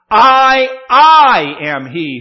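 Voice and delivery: "I, I" boomed loud, each one held; capitals and bold. Shown as a demonstration. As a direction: punch both "I"s like bold capitals. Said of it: "this is capitals and bold".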